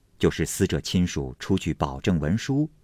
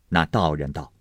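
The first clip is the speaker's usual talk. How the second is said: usual speaking voice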